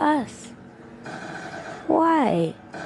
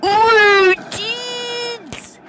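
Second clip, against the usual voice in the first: excited shout; 9 semitones higher than usual. Retold or imitated. Cousin shouting to the boys, excited. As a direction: howl it at your friends. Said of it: "and then he was like".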